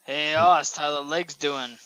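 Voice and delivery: ordinary talk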